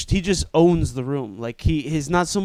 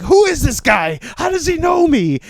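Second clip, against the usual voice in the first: imitated freak-out; 11 semitones higher, 5 dB louder than usual. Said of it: "he's, like, freaking out".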